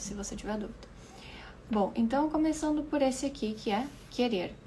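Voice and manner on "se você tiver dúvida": sussurrado. Real, not acted. Aside sussurrado.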